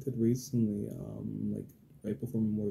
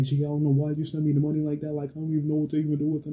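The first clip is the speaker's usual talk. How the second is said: loud, high imitation of his outburst; mock his indignant freak-out, raised voice; freaking out, attitude; 5 semitones higher, 7 dB louder, faster than usual. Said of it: "freaking out, and he got, like, attitude".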